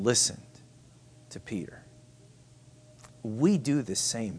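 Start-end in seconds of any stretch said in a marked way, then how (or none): 1.3-1.8 hushed, almost no voice; whisper. Real, not acted. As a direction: breathe the name in a whisper. Preacher drops to whisper.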